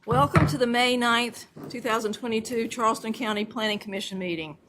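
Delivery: usual speaking voice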